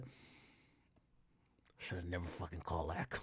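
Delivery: slow, soft regretful [name] voice; mock regretful [name]. Imagined [name] regretting the call, slow and soft. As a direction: lament it slowly, a hint of [name]. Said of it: "You know what [name] said?".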